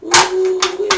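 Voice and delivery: high, loud whoop of surprise; excited ooh-wee. Real, not acted. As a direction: let it fly up like a revelation. Sudden realization during card reading.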